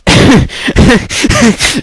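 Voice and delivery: breathy, helpless laugh; burst of laughter. Real, not acted. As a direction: crack up mid-sentence. Laugh bursting out mid-story.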